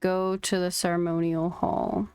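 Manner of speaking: ordinary talk, nothing marked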